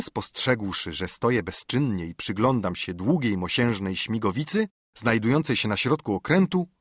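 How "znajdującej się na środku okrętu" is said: ordinary delivery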